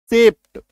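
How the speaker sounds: सेप्ट with the final ट sounded, falling; demonstrated pronunciation. Shown as a demonstration. Teacher shows class how it's pronounced, demonstrated pronunciation. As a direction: say सेप्ट crisply as one syllable plus ट. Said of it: "इसका उच्चारण जानते हैं क्या होता है?".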